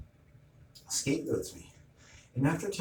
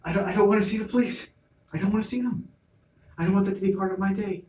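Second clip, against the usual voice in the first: higher, louder and fast, pleading; exasperated outburst; 8 semitones higher, 4 dB louder, faster than usual. Retold or imitated. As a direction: blurt it out, fed up and rushed. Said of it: "it's like".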